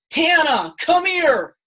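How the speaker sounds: retold call out. Trump calling her over, retold.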